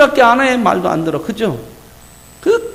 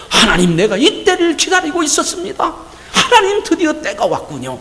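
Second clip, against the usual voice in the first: high, laughing eager acceptance; eager hypothetical Moses; 8 semitones higher than usual. Pretend. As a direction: play Moses thrilled at last. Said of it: "이렇게 나와야".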